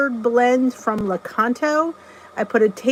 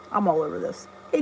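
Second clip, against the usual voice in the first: ordinary talk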